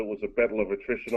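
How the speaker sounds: normal talking voice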